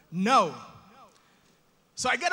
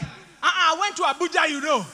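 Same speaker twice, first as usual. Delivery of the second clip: loud, high West African reply; shouted conversation; 4 semitones higher, 7 dB louder than usual. Acted. Nigerian passenger answering his friend across the train, shouting. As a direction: call back boisterously across a carriage. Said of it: "you're shouting".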